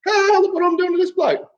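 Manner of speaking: high, gloating taunt; mock gloating cop. Mocking officers gloating on film, high and smug. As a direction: show off like a cop mugging for the camera. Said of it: "These guys waving at the camera".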